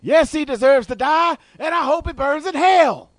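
high, slow Jackson impression; Samuel L. Jackson voice. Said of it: "Samuel, what do you think".

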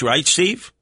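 ordinary talk

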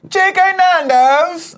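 loud, high, drawn-out British accent; hammed-up British accent. Shown as a demonstration. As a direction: go all out on an exaggerated British accent. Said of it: "try your hardest at the accent".

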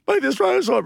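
high-pitched imitation of crying; retold tearful high voice; mock tearful. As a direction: squeak it out as if bawling. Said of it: "I started crying, bawling".

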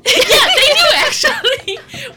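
laughing. Real, not acted. Laughing reply about Cracker Barrel.

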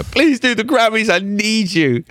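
high pleading whine; mock begging. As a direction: beg like a desperate fan, hammed up.

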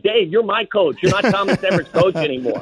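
mock indignant protest. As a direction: object in exasperated disbelief, half-joking. Retold protest to his coach.

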